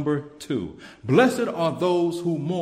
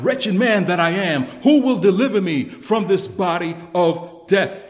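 cried out; 3 semitones higher, 8 dB louder than usual. Acted. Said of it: "who cried out".

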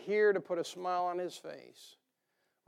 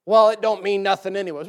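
loud, careless brush-off; dismissive shrug; 9 dB louder than usual. Retold or imitated. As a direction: toss it away like it doesn't matter. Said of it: "You're like".

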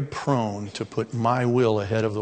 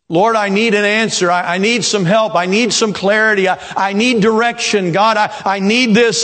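urgent pleading prayer; 8 semitones higher, 6 dB louder than usual. Retold or imitated. Mock desperate prayer to God.

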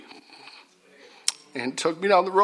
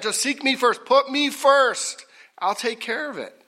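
emphatic urging; 7 semitones higher than usual. Retold or imitated. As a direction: urge them to trust you, voice raised in pitch. Voices God's urging to trust.

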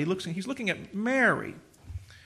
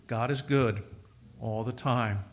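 God is good said low and soft; hushed, settled affirmation; quiet refrain; 8 semitones lower, 6 dB quieter than usual. Real, not acted. Same refrain, softly.

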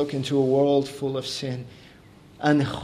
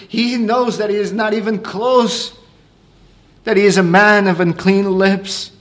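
higher, louder preacher at full force; fervent preaching; 6 semitones higher, 5 dB louder than usual. Acted. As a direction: preach it, rising with awe at God's holiness. Preacher retells Isaiah with fervour.

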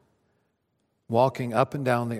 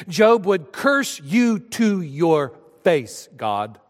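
usual speaking voice

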